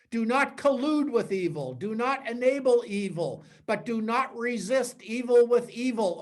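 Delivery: ordinary talk, nothing marked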